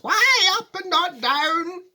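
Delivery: high, loud, drawn-out demand; shouted protest. A narrator's reading. Alarmed passengers challenging Wonka, loud drawn-out shout. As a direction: shout it, stretching the words. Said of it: "they all shouted at once".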